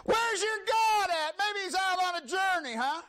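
high, hollered jeer; scornful high-pitched heckling; mocking taunt. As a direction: taunt them as Elijah, high and stretched out. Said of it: "Elijah mocked them".

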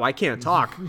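ordinary talk

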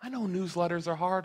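normal talking voice

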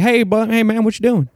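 high, bright mock greeting from a barging-in neighbor; hammed-up friendly neighbor call; cheery neighbor greeting.

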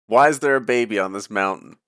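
ordinary talk, nothing marked

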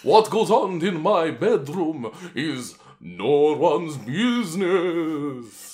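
very slow, drawn-out vampire. Pretend. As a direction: savour each word like a count. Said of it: "vampire voice you're gonna add".

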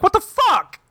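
high, startled outburst, mildly hammed; mock shocked. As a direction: imitate his stunned disbelief. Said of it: "He'll be like".

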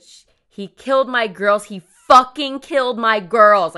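loud, emphatic cry, building to a half scream; retold scream. Mother telling the dispatcher of the killings, imitated scream. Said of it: "Like, she's screaming".

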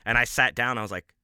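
ordinary talk

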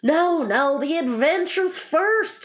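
loud, high, drawn-out insistence; impatient. A narrator's reading. Griffin overrules the Mock Turtle, impatient. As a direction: cut in high and forceful. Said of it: "in an impatient tone".

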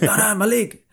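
high, pained whine about the leg; cramped pain. Retold or imitated. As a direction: cry out as the cramp grips.

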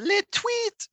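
very high little bird chirp; cartoon bird. Bird on a branch in a skunk cartoon, high chirping. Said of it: "the bird's like".

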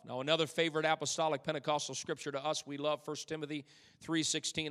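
ordinary talk

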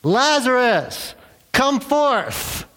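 high, drawn-out yelled command; movie-Jesus yell. Acted. Movie Jesus summoning Lazarus from the tomb, yelling. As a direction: call out grandly to raise the dead. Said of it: "usually Jesus is, yells out".

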